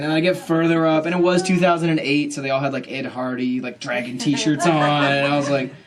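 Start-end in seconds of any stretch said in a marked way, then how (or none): none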